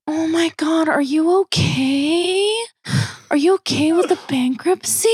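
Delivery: high, slowed fake concern; mock concerned acquaintance. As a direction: ask it with syrupy pity. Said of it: "she was like".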